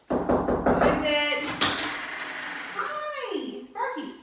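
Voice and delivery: high, sing-song greeting to a dog. Real, not acted. Greeting another dog, baby talk.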